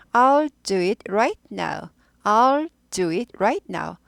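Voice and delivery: "I'll do it right now" very slow, each word apart; slow model sentence. Shown as a demonstration. Teacher slowly modelling the line for students to repeat. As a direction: drill it slowly for learners. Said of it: "I will 하지 않고 I'll".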